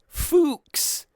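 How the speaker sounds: sudden high-pitched outburst of one word; mock exclamation. Shown as a demonstration. Streamer showing a nickname that sounds rude, mock exclamation. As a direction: blurt it out high, as a joke. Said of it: "I can't just go like".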